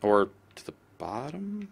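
breathy whispered question to himself; slow whisper, almost under his breath; whispered aside. Real, not acted. Muttering to himself over the map in a slow whisper.

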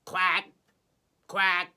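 loud, single duck quacks; imitated duck call. Shown as a demonstration. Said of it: "A duck?".

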